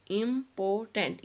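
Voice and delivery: Important split into im, por, tant; syllables separated. Shown as a demonstration. Teacher showing children how to separate syllables, syllable by syllable. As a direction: say important one syllable at a time. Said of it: "इस तरह से बच्चों आपने इसके syllables को separate करना है".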